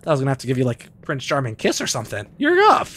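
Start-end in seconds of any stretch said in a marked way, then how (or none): 2.4-2.9 high, loud delighted cry; light up as she wakes; excited. Real, not acted. Gamer cheering as companion wakes.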